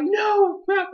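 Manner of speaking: high, pained plea; a hint of Natsu begging his friend to stop; pleading cry. Natsu begging Happy to stop, high pained plea. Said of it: "and then Natsu's like".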